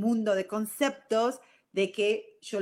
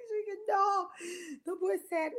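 higher, laughing disbelief; 10 semitones higher than usual. Real, not acted. Quoting her reply to the cashier, still laughing high.

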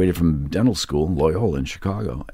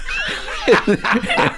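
high burst of laughter. Real, not acted. Laughing hard at dad's story.

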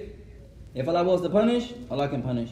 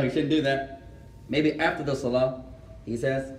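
ordinary delivery

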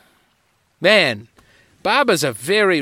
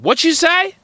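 high, louder mock challenge; 12 semitones higher, 5 dB louder than usual. Tough barber snapping back at his protesting customer, mock challenge.

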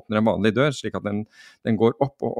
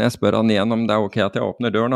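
normal talking voice

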